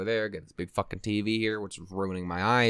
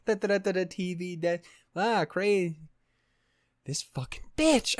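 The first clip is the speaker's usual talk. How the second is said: mock inner rant; 7 semitones higher than usual. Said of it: "I was like".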